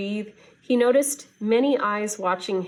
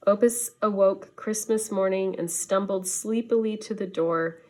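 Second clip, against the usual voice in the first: plain reading voice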